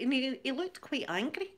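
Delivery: ordinary delivery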